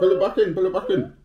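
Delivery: high, loud, laughing plea; excited urging. Real, not acted. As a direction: cheer them on while laughing.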